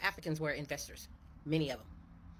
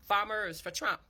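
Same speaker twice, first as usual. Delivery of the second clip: "Farmers for Trump" called out louder, rising on "Trump"; 5 semitones higher, 5 dB louder, slower than usual. Real, not acted. Calling Trump-supporting farmers to come listen, louder. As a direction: summon the crowd in.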